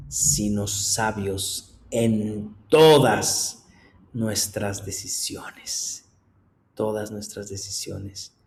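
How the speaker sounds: slow emphatic. Acted. Slow stressed repetition.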